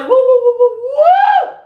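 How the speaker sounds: very high, loud whooping; excited woo. Real, not acted. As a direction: whoop like a party crowd. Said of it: "I'm excited".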